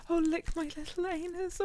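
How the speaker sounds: ordinary talk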